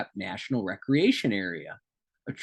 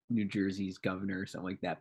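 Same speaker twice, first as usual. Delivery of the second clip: ordinary talk, nothing marked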